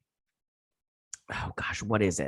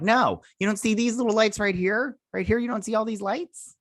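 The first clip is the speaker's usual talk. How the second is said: high, mock-exasperated pointing out the lights; pitched-up mock disbelief; incredulous retort; 12 semitones higher than usual. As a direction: play it as a disbelieving 'are you blind' reply. Speaker retells her own retort.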